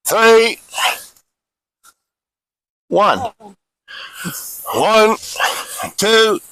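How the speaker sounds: spaced-out count, each number held level and firm; firm, deliberate exercise count; drill cadence count. Real, not acted. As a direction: call out the reps like a drill instructor.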